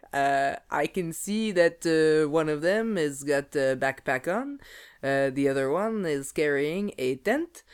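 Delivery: put-on slow Quebecois accent narrating; Quebecois narrator. Narrating the film to her co-host in Quebecois accent. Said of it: "let me narrate it for you".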